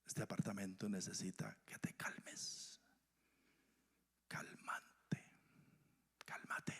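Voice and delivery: breathy unvoiced calming whisper; soothing whisper. Preacher whispers calm to an angry heart, hushed and soothing. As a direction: hush someone down gently.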